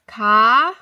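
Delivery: ordinary delivery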